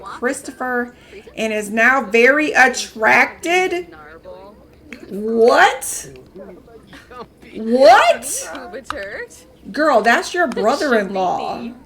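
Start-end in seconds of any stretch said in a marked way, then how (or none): 5.1-8.8 loud, rising then falling what; stunned disbelief. Real, not acted. Streamer reacts to Sim crushing on in-law, loudly shocked. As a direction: cry out in disbelief twice.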